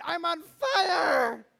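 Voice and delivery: high, drawn-out yell; hammed-up panicked holler; acted shout. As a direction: cry it out loud, stretching the last word. Meme's inner cry, acted.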